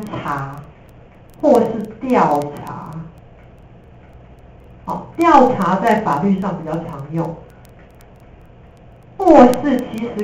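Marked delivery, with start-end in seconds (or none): none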